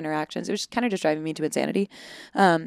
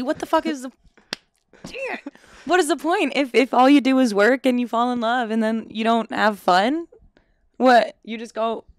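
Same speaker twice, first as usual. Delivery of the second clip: exasperated rant; 5 semitones higher, 4 dB louder than usual. Real, not acted. Exasperated rant about hobbies.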